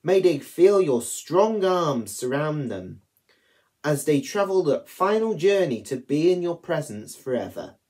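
ordinary talk, nothing marked

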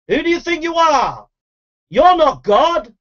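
indignant rebuke. Real, not acted. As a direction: put the proud listener in place. Rebuking angry listener.

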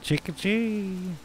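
slow, high squirrel-call imitation; squirrel chatter. Streamer echoing the game's squirrel call, slow high chirp. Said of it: "like a squirrel".

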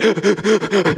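gasping, hiccupy imitation of a crying kid; mock sobbing. Preacher imitating kids who can't talk, mock sobbing. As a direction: sob in quick bursts, too choked to talk. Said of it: "they can't even talk".

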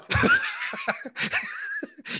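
big high burst of laughter. Real, not acted. Host laughing at first-time parents, a big high laugh. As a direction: crack up hard, way up high. Laughing about raising kids.

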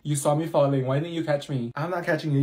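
ordinary talk, nothing marked

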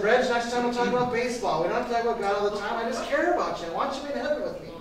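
pleading. Retold or imitated. Pastor calls back angry friend, hurried pleading. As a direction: plead in a rush to win him back.

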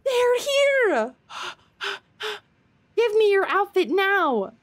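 high, loud and thrilled; excited shout. Real, not acted. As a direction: blurt it out in delight. Excited at the DLC arrival.

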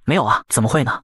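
ordinary delivery, nothing marked

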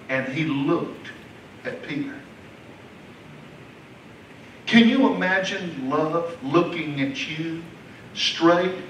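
soft intimate pulpit voice; tender hushed preaching. Acted. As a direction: draw them in close and gently. Tender quiet passage on Jesus' look.

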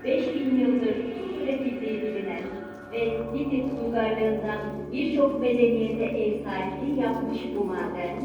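ordinary talk, nothing marked

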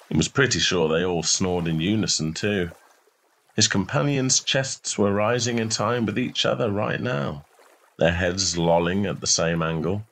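plain reading voice

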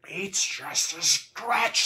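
high, loud, drawn-out angry yell; angry scream. A narrator's reading. Fighter screaming at someone to do their job, angrily. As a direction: yell it slowly, pitch climbing. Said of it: "started to scream angrily".

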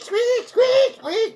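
very high squeaky mock squeals; imitated guinea pig squeaks. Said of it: "he would squeak".

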